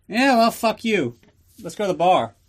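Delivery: hammed-up drunk Ian Fleming, high and loud; drunken retort. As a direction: brush him off like a tipsy writer heading to the bar. Drunk Fleming voiced in story.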